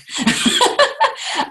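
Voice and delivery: airy burst of laughter; breathy laugh. Real, not acted. Laughs at own story.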